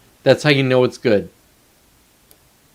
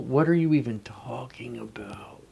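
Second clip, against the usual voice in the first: incredulous whisper to himself; whispered disbelief; 2 semitones lower, 10 dB quieter than usual. Real, not acted. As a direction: whisper it, baffled by the text. Reacting to baffling instructions.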